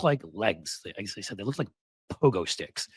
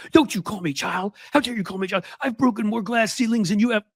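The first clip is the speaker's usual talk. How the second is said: high-pitched mock outraged shriek; mock screaming; 9 semitones higher than usual. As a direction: imitate an indignant scream, pitched way up. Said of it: "started screaming out".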